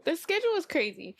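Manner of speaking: usual speaking voice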